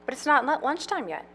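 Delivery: put-on high, eager man-child voice; childlike Farley. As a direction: whine it like an excited kid. Farley protests.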